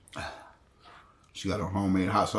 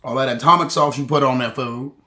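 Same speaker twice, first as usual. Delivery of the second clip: loud, higher exclaiming about the spice; loud complaint; 6 semitones higher, 15 dB louder than usual. Real, not acted. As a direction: call it out loudly. Complaining the sauce is hot.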